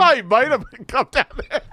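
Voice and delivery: put-on high, loud parody of an Australian accent; mock accent. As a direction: mimic a bad Australian accent. Mimics co-host's put-on accent.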